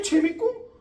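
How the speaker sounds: ordinary talk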